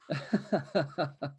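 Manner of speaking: short laugh at the rule; amused laugh. Real, not acted.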